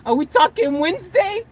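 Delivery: high, rising eager question; excited disbelief. Real, not acted. Man working out his bus arrives Wednesday, excited. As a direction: build excitement as the date sinks in.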